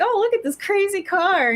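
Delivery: put-on high, gushing child's voice; excited little kid. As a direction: gasp at it like a wide-eyed kid. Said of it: "they're like".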